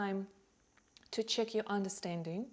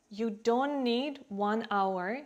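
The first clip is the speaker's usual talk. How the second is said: ordinary talk, nothing marked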